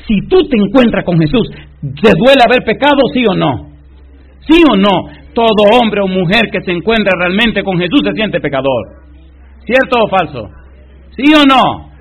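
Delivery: loud, high preacher's challenge; fiery sermon call to the crowd; full-force preaching. Acted. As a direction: hammer the question at the congregation.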